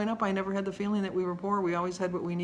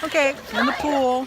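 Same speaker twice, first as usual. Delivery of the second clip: louder, higher, calling out; gleeful shout from the pool; loud excited announce; 6 semitones higher, 8 dB louder than usual. Real, not acted. Splashing in the pool.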